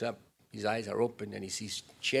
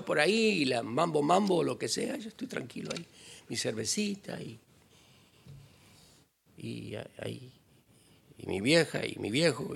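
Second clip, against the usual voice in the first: carefree Spanish speaker; 5 semitones higher than usual. Retold or imitated. Careless man bragging of beer and girls, in Spanish accent. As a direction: play the carefree man who doesn't care. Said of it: "others will say".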